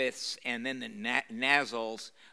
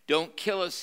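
higher, louder tearful Gollum plea; weeping Gollum; 6 semitones higher, 5 dB louder than usual. A narrator's reading. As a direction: cringe and beg, half in tears. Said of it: "he wept".

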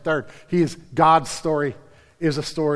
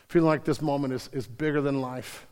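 ordinary delivery

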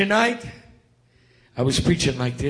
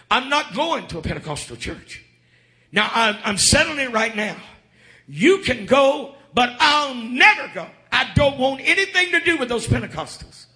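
indignant mama, pitched higher and climbing; adamant refusal; 11 semitones higher, 3 dB louder than usual. Retold or imitated. Mama refusing her children's plea to come to church, adamant. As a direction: dig in harder with each phrase.

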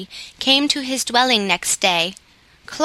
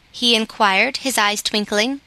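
ordinary delivery, nothing marked

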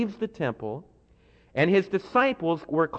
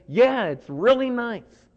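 higher, slow, drawn-out "it's really nice"; polite agreement; 8 semitones higher, 4 dB louder, slower than usual. Retold or imitated. Friend answering at the Meyerson, high and slow. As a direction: humour the friend with slow, lifted praise. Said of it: "and they turn to you and say".

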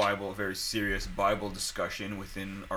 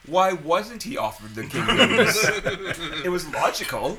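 higher, louder, slow and laughing; 7 semitones higher, 5 dB louder, slower than usual. Real, not acted. Retelling the friend's genius question, laughing. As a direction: say it while cracking up.

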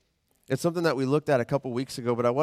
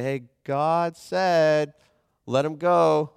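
mock nervous announcement; 3 semitones higher, 6 dB louder, much slower than usual. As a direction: blurt it out, stretched and loud. Moses imagined before Pharaoh.

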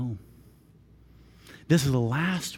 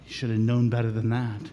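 normal talking voice

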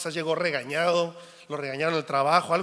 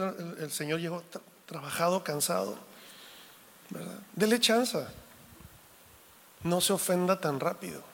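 usual speaking voice

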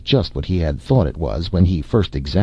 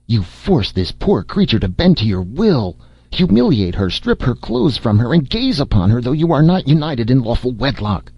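rising, much higher righteous tirade; outraged accusation; 7 semitones higher than usual. Acted. Micah denouncing Jason's immoral bath, pitch climbing in outrage.

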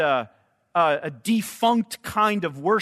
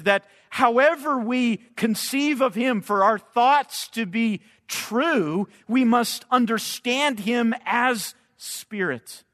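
usual speaking voice

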